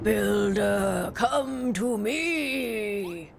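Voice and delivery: very slow, eerie calling; spooky voice. Acted. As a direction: lure them in, ghostly and drawn out. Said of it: "What the heck was that spooky voice?".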